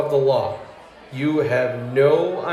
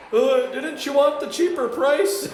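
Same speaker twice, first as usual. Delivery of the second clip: much higher, slow, dopey innocent voice; clueless clerk; 13 semitones higher than usual. Retold or imitated. Yo innocently tells the furious customer about the price. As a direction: play it dumb and innocent.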